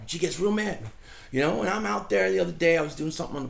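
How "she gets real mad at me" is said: usual speaking voice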